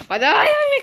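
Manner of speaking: very high startled cry; sudden yelp. Real, not acted. Player startled as the fire starts, yelping. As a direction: jump in alarm.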